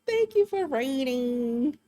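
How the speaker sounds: slow, high robotic thank-you; automated voice. Retold or imitated. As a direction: read it like a text-to-speech bot.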